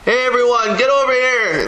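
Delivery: high-pitched mock yell to a crowd; calling out. Leader gathering troops without a microphone, calling out. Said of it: "so you had to scream out".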